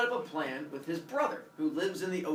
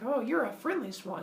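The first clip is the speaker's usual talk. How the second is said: lightly voiced, higher-pitched delighted greeting; charmed mock greeting; 6 semitones higher than usual. Woman meeting a friendly swan, charmed. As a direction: lift it in playful delight.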